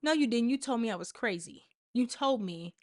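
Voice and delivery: usual speaking voice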